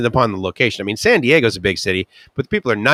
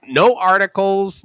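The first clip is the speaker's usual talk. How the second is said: normal talking voice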